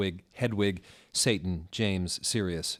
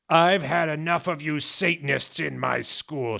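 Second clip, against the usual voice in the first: put-on higher, louder shout in an old wizard voice; shouting Dumbledore; 8 semitones higher, 7 dB louder than usual. Headmaster shouting at Satanists in school. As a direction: rage at the pupils. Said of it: "shouted Dumbledore spuriously".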